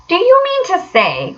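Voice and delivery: can hardly believe it; excited cry. A narrator's reading. Excited Rat pressing the dense Mole. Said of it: "cried the excited Rat".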